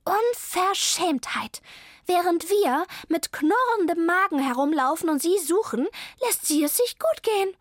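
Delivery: high-pitched indignant cat; furious hissing. A narrator's reading. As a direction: rage at the cheek of it. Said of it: "Zelda fauchte wütend". (wütend fauchend, mit hoher Stimme)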